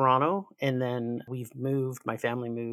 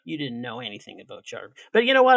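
normal talking voice

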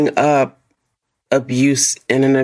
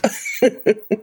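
light laugh; 7 semitones higher, 3 dB quieter than usual. Real, not acted. Laughs at own voice.

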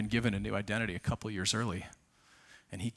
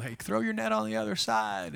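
high called-out voice of Jesus from the shore; a hailing shout from the beach; calling out; 11 semitones higher than usual. Acted. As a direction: call across the water, pitched high. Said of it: "he called out to Peter and called".

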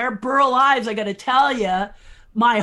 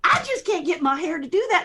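higher-pitched country star imitation; 6 semitones higher, faster than usual. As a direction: lift the voice into a playful star impression. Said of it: "and she said".

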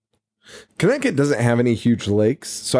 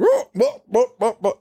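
high, repeated cartoonish "oh" cries; mock struggling yelps; 13 semitones higher than usual. Retelling the diver fighting off the alligator, mock struggling yelps. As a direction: imitate someone flailing up to the surface, pitched way up. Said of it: "And he's like".

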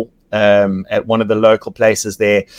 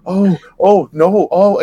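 put-on Jack's full accent; 6 semitones higher, slower than usual. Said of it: "his full accent comes out".

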